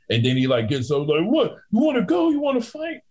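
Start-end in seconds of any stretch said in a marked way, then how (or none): 1.2-3.0 much higher mock tough guy; mock drunk picking a fight; drunk challenge. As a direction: puff up like a drunk.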